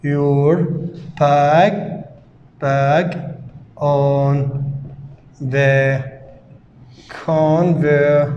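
each word said slowly and repeated; slow dictation. Shown as a demonstration. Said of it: "teacher dictates word by word". (إملاء بطيء كلمة كلمة)

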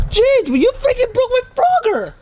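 high, exasperated complaint; outraged accusation. Real, not acted. Accuses the other of breaking Frogger, outraged. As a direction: protest at the top of your range. Quarrel over broken Frogger.

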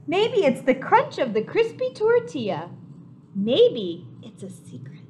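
storybook reading voice; gentle picture book reading; reading to children. A narrator's reading. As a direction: wonder aloud for young listeners.

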